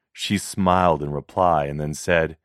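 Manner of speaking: ordinary delivery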